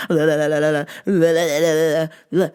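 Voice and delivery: low, rapid la-la tongue noise; mock a suck-up with slobbery la-las; mock fawning babble. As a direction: crude imitation of sucking up. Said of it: "that's you trying to suck dick".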